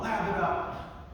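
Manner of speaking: usual speaking voice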